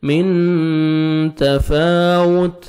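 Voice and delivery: تفاوت very slow, lips rounded on the واو; slow tajweed drill. Shown as a demonstration. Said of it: "واو پہ lips کو round میں لے کے جانا ہے".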